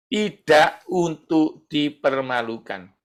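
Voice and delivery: slow, measured "tidak untuk dipermalukan", word by word; slow emphatic repeat. Shown as a demonstration. Preacher repeats a key point to the congregation, slow and emphatic. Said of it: "Saya ulangi".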